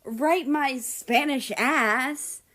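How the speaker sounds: slow, seething hiss; angry hiss. A narrator's reading. Mexico hissing at America while thumping his back. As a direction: spit it out through your teeth. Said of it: "Mexico hissed".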